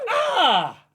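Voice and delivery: high, loud pretend fright; dad pretending his toddler scared him, mock scream. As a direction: fake being startled.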